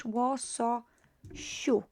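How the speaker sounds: "Łososiu" very slow and quiet as the incorrect spelling; wrong form shown. Shown as a demonstration. Teacher showing students the form Polish forbids, slow and quiet. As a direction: draw out "Łososiu" softly to show the mistake. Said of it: "you cannot have this in Polish".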